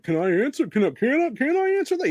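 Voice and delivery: hammed-up nervous politician begging to speak; mocking whiny Tim. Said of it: "Tim's like".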